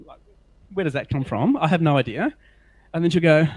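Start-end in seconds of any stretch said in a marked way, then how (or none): none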